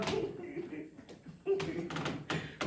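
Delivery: high, soft breathy laugh; laughter. Real, not acted. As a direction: laugh helplessly at your own demonstration. Laughs at own demonstration. (rire léger et aigu)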